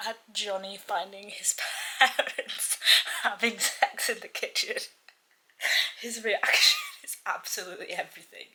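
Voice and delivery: giggling through the words. Real, not acted. Reader to viewers about a funny scene, giggling. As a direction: laugh while telling it. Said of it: "I'm giggling right now".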